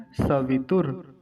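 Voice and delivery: slow "सवितुर", sa-vi-tur; careful standalone model "सवितुर"; separated word. Shown as a demonstration. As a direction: draw out each syllable of "सवितुर". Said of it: "सवितुर को अलग करेंगे".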